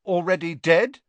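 supply the missing words obligingly; helpful. A narrator's reading. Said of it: "said Ron helpfully".

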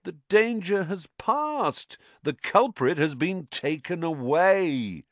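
high, slow, patronizing singsong; slow and condescending. A narrator's reading. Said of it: "speaking slowly, as though they were all a bit dim".

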